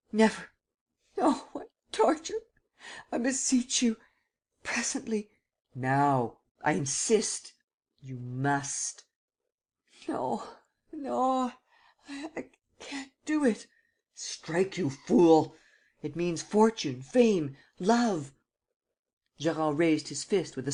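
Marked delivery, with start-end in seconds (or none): 0.1-13.7 high, whispering, near tears; terrified pleading. A narrator's reading. Terrified youth refuses torture. 14.1-18.3 urging forcefully. A narrator's reading. Prince urging the blow.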